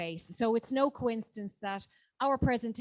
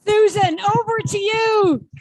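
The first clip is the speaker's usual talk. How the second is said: calling out; 12 semitones higher, 14 dB louder, slower than usual. Real, not acted. Chair calls next speaker over.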